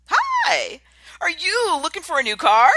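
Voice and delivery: eager salesman at full energy; energetic greeting. Shown as a demonstration. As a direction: greet them like you're thrilled they came. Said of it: "It's totally different".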